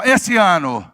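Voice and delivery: loud call-out to the congregation; big, loud chant-leading shout; rallying shout. Shown as a demonstration. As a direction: shout it out for everyone to repeat. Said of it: "dá um grito aí e fala bem alto".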